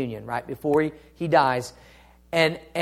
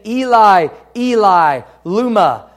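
loud cry; 3 semitones higher, 7 dB louder than usual. A narrator's reading. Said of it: "Jesus cried with a loud voice".

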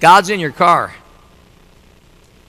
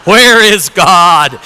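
loud, slow preacher's boom; 2 semitones lower, 6 dB louder, much slower than usual. Acted. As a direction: boom the question out, drawing it long. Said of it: "in a booming preacher voice".

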